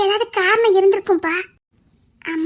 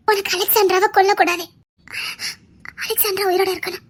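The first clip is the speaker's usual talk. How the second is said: ordinary talk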